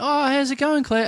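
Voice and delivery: bright, high friendly hello; cheery greeting. Retold or imitated. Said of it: "sat down next to you and went".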